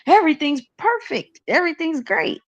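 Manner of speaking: high, sing-song fake positivity; a touch of sugary denial; mock cheerful. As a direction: pretend everything is fine. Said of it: "I'm not gonna sit up here and be like".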